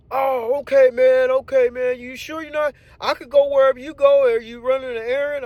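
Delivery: squeaky grateful coworker; coworker's high voice. Retold or imitated.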